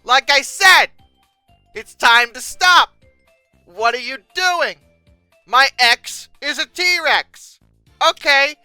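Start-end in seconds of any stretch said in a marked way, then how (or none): none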